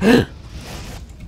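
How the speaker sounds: sharp unvoiced gasp; a quick frightened intake of breath; startled gasp. Real, not acted. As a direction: gasp in surprise. Startled by zombie.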